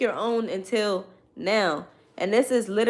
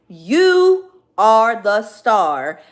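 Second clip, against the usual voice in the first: slow, louder, ringing declaration; slow loud proclamation; 7 dB louder, much slower than usual. Real, not acted. Reader proclaims to the viewer, loud and slow, they are the star. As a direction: belt it out like a crowning. Repeated affirmation, second take.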